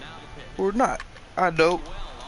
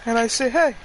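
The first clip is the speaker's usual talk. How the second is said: much higher, strained squeak after a nutshot; high squeaky injured voice; 13 semitones higher than usual. Pretend. To the doctor, telling the batting cage story in a squeak. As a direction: squeak it out like your nuts just got hit. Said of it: "That's why I'm talking like this".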